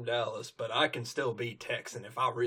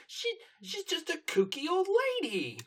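very high-pitched bratty character squeal; Diamond Tiara. Acted. Schoolgirl turns on a classmate's granny, very high-pitched taunt. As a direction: screech it way up high, mocking. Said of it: "Diamond Tiara just turns".

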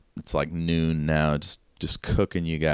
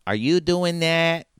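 redone Jill line; 9 semitones higher than usual. Acted. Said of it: "Wait, Chicago".

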